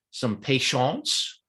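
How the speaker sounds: slow, drawn-out mock French accent on patience; lightly hammed French accent, slow. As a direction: stretch it like a playful Frenchman. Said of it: "that French accent came out early".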